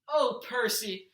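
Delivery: slow, drawn-out loving sigh of his name; mother hugging her son home; tender greeting. A narrator's reading.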